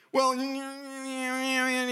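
high slow whine; high whining protest; whiny complaint. Retold or imitated. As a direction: drag out a whiny well. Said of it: "he's complaining some more".

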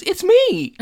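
very high, loud gleeful cry; gleeful announcement. Retold or imitated. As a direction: burst in thrilled to be on air. Eric gleefully announces himself on air.

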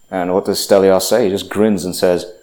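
normal talking voice

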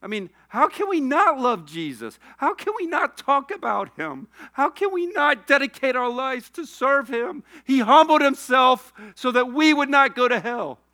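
high, crying sermon appeal; high-pitched emotional preaching, swelling to loud; tearful impassioned plea. Real, not acted. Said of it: "I'm crying talking about Irv".

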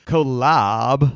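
put-on Valley Girl voice; drawn-out Valley Girl drawl. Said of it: "you know what she says".

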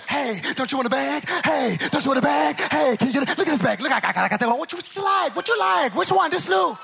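loud hawking vendor voice; pushy street vendor. Retold or imitated. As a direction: hustle the passerby into buying. Chinatown street vendor hawking bags.

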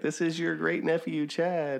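lightly voiced polite nephew on the phone; mock polite phone voice. Introducing himself to old uncle.

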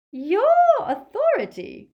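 very high, loud, scornful question; Bellatrix's sneer. A narrator's reading. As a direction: sneer in disbelief. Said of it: "she sneered".

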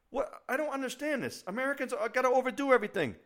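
high, loud exasperated rant; high-pitched outraged complaint. Retold or imitated. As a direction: rant it out, voice climbing in disbelief. Ranting quote of own reaction.

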